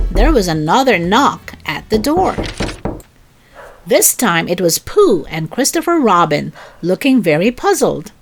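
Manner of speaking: ordinary delivery, nothing marked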